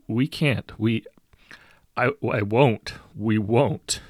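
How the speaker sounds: loud, faltering plea; desperate. A narrator's reading. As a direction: stumble into a desperate refusal. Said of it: "the first mate says desperately".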